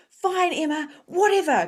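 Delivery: high, loud dismissive retort; exasperated giving-in. Retold or imitated. Medium retelling her reply to spirit Emma, exasperated. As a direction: throw it out high, fed up. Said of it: "conversations out loud with spirit".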